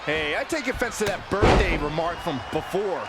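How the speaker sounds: usual speaking voice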